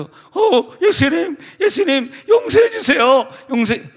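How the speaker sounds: high, loud desperate cry for mercy; frightened pleading. Acted. Woman begging Jesus for forgiveness, panicked high shout.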